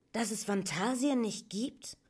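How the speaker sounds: put-on high, aghast young questioner; stunned disbelief. A narrator's reading. Atreyu repeats what Gmork told him, stunned. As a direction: repeat it back as an incredulous question. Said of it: "wiederholte Atreyu fassungslos".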